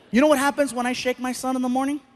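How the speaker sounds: normal talking voice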